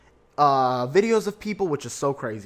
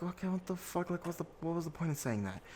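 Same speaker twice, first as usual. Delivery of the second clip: much softer, muttered retold reaction; exasperated aside; 13 dB quieter than usual. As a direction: mutter your bewilderment under the breath.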